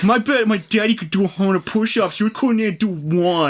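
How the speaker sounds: put-on high bratty kid voice; playground taunt.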